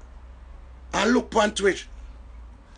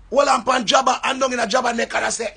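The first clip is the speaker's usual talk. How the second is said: normal talking voice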